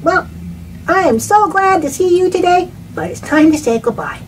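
high, loud sing-song farewell; loud high goodbye to the viewers; cheery goodbye. Acted. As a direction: wave the kids off brightly.

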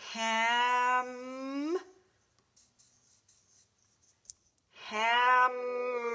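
ham- held, then hammer drawn out slowly; sounding out slowly. Shown as a demonstration. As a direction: build the word hammer sound by sound. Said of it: "keep going and write the word".

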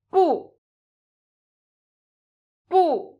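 exaggerated fourth tone "Bu"; falling tone bu. Shown as a demonstration. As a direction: snap "Bu" down hard like refusing. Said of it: "pronounced with a slight exaggeration, like an angry no".

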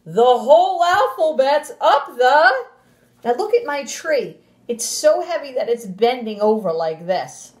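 lively, sing-song storybook voice, well above her normal pitch; animated read-aloud to a class; reading to children. Acted. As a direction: read it big and bouncy for little kids. Teacher reads picture book aloud.

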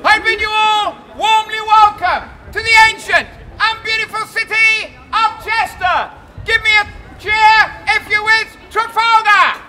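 booming public proclamation; town crier. Acted. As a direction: proclaim it to the whole square. Welcomes crowd as town crier.

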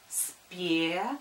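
spelling pronunciation; dictating. Shown as a demonstration. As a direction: break «Спе-к-та-к-ль» into written parts. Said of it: "Пишу". (диктует по слогам)

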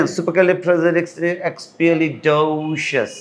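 supercalifragilisticexpialidocious with DO drawn out; stress on DOCIOUS. Shown as a demonstration. Teacher showing where the stressed syllable is, long DO. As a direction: rattle through supercalifragilisticexpialidocious, stretch the "do". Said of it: "এখানে টানটা হচ্ছে docious".

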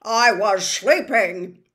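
slow, loud grumbling spider; old spider. Acted. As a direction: complain like a woken old spider. Aragog complaining.

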